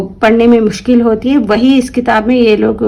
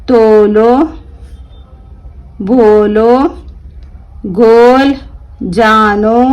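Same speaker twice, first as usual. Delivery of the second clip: slow word-list reading; much slower than usual. Shown as a demonstration. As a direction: read every word clearly for the class. Reads word list aloud. (धीमे और साफ़ शब्द पढ़ना)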